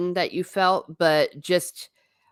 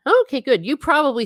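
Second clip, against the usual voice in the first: surprised approval; 10 semitones higher, 6 dB louder than usual. Real, not acted. Reacting to a chatter's comment.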